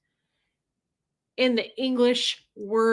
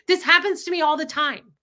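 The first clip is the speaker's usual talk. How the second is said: raised, higher frustrated complaint; higher, louder, fed up; upset outburst; 6 semitones higher, 4 dB louder than usual. Retold or imitated. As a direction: vent the frustration at him. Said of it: "And I was like".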